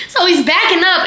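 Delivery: usual speaking voice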